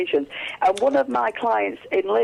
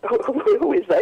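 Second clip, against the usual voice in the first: high startled question, repeating who; mock-startled inner voice, much higher; a sudden high jolt of surprise; 10 semitones higher than usual. Startled thought at stranger.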